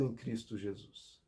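drops to a near-hush on the name; hushed ending. Real, not acted. Pastor closes the promise to the congregation in a hush. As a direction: let "em Cristo Jesus" fall soft and low.

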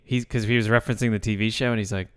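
normal talking voice

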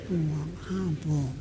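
low, slow, very soft murmur; hushed aside. Real, not acted. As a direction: drop right down to a slow murmur. Amused aside after the imitation.

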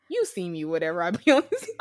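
normal talking voice

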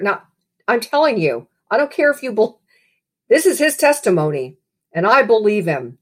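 usual speaking voice

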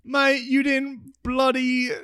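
hammed-up Australian accent; mock Australian accent. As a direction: quiz a mate in a put-on Australian voice. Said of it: "I wish I could talk like that".

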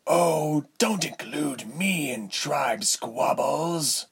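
silky, tutting tyrant; tutting disdain. A narrator's reading. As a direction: wave off the accusation with slow condescension. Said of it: "Althod tisked".

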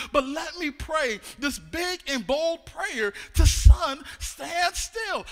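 preacher shouting a prayer; loud preaching. Acted. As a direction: call out the bold prayer.

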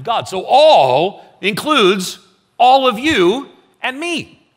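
fervent sermon warning; preaching at full force. Acted. Pastor preaches on judgment.

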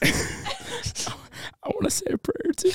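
breathy laughing whisper; giggling whisper. Real, not acted.

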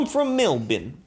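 broad Australian self-introduction; Australian accent. Acted. Performer naming cities on request, broad Australian accent. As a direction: put on a thick Australian. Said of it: "I'll try to do an Australian accent".

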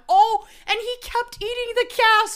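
high, loud, appalled; disgusted outrage. Real, not acted. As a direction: cry out in horror at it.

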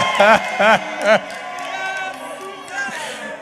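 long loud laugh. Real, not acted. Preacher still laughing at the fans, loud laugh. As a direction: laugh it out fully.

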